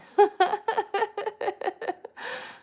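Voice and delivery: loud, high burst of laughter; high cackling laugh that tapers off. Real, not acted. Laughs at own scary singing.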